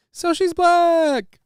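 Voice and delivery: very high, slow comedian impression; drawn-out, high-pitched stand-up whine; Jerry Seinfeld. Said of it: "Jerry Seinfeld".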